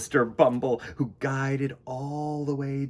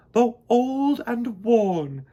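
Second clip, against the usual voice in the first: higher, louder, slow regal voice; stately queen; 9 semitones higher, 8 dB louder, much slower than usual. Acted. Queen bee honoring the old bucket for future bees. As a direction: speak it like a wise queen. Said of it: "said the queen bee".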